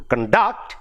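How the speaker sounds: "conduct" as con-DUCT, rising onto DUCT; stress on second syllable. Shown as a demonstration. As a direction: say "conduct" as a verb, stress the end. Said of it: "it becomes a verb".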